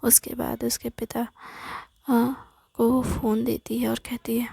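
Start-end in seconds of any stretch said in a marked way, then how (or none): none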